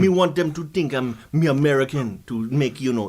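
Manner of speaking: matter-of-fact put-on Jamaican patois; deadpan mock Jamaican accent; fake Jamaican accent. Guest explaining his YouTube voice, fake Jamaican accent. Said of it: "this is not your real accent".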